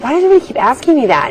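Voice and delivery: high, fast, exasperated retort. Retold or imitated. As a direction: fire back, fed up with being asked. Retold exasperation at repeated questions.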